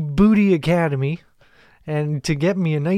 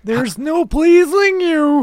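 much higher, slow, loud mock whine; mock exasperated whine; 12 semitones higher, 6 dB louder, much slower than usual. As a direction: wail it like a spoiled diva.

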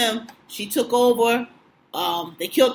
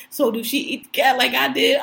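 normal talking voice

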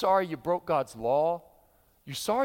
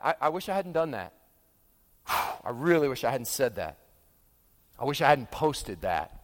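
mock regretful sighing; 7 semitones lower than usual. Voicing everyday regret.

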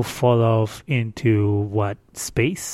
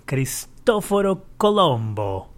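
put-on Italian accent on the name; Italian-style pronunciation of the name; 4 semitones higher than usual. Shown as a demonstration. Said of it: "That's my best, um, Italian accent".

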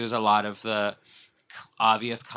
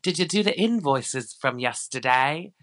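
high mock accent, drifting Irish; Lisa Vanderpump; 6 semitones higher, 4 dB louder, slower than usual. Host mimicking Lisa questioning Max, botched Irish-sounding accent. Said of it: "I don't know why she's, like, Irish now".

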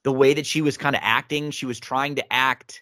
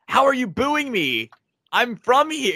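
mock-indignant wrestler, pitched higher; imitating Zelina; 10 semitones higher than usual. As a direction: play the offended heel, high and put-upon. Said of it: "she's like".

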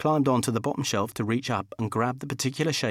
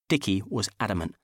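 plain reading voice

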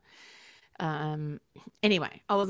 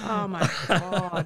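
slow, laughing exclamation; slower than usual. Real, not acted. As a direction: laugh it out slowly. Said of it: "laugh heard".

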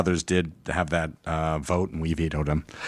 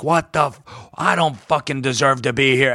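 mock angry outburst, pitch raised; retelling dad's pissed-off complaint, half-hammed; imitating furious dad; 6 semitones higher than usual. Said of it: "he was pissed".

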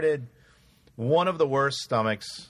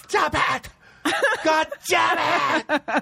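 high-pitched furious shout, imitated; angry wife; 4 dB louder, much slower than usual. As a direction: yell like an exasperated wife, drawing out the last words. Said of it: "he got so mad. Jennifer would be like".

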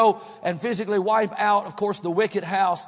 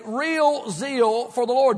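"real zeal for the Lord" drawn out slowly; slow refrain; 3 semitones higher, much slower than usual. Shown as a demonstration. Preacher repeating his refrain to the church, slow. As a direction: stretch the refrain slowly for emphasis. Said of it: "I will say regularly today".

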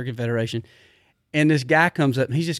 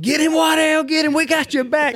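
much higher, loud egging-on yell; rowdy crowd shout; 12 semitones higher, 3 dB louder than usual. Retold or imitated. Hunters cheering Waddell on against security, loud high yell. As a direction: yell it like fans egging on a brawl.

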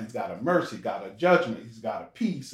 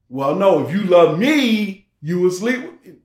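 loud, higher, pressuring suitor act; mock insistent demand; 4 semitones higher, 9 dB louder than usual. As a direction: push the demand hard, stretching the condition. Man pressuring a girl, acted.

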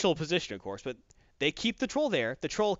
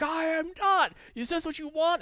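much higher troll voice, losing his temper; a high put-on troll snapping back; mock high troll outburst; angry troll; 11 semitones higher than usual. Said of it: "It's like".

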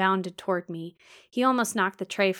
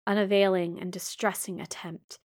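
plain reading voice

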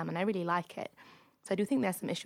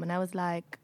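ordinary delivery

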